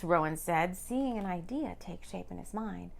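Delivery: ordinary delivery, nothing marked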